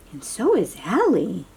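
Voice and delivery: usual speaking voice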